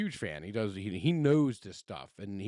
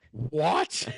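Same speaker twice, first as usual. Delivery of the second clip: baffled what; 6 semitones higher, 7 dB louder than usual. Retold or imitated. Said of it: "both of them looked at me like".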